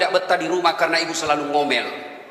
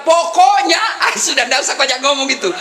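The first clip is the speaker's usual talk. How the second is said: loud, high "Pokoknya" like a nagging wife; imitating a wife who won't listen; mock wife's outburst; 6 semitones higher, 4 dB louder than usual. Preacher mimics a stubborn wife ending the argument, loud mock outburst. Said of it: "kalau dia sudah bilang".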